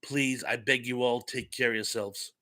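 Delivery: normal talking voice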